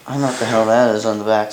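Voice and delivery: ordinary talk, nothing marked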